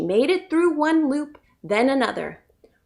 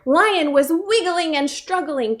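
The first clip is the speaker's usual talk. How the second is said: ordinary delivery, nothing marked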